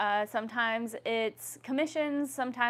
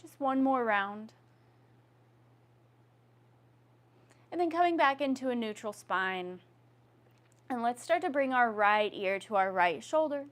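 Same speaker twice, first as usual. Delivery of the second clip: yoga instruction. Acted. Leads yoga neck movements.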